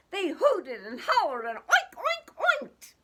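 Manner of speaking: high, loud squealing oinks; oinking pigs. Acted. Reading to kids, oinking like excited pigs. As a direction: squeal it like a muddy pig.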